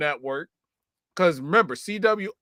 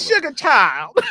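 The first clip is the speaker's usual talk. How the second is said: loud, higher, drawn-out mock endearment; teasing Madea-like call; 7 semitones higher, 8 dB louder than usual. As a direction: draw it out loud to tease your co-host. Teasingly echoes co-host's Madea jab.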